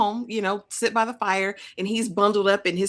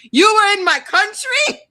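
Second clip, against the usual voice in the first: high, loud indignant question; hammed-up disbelief, voice shooting up; mock outrage; 12 semitones higher, 5 dB louder than usual. Acting out his reaction to her Morocco confession, mock outraged.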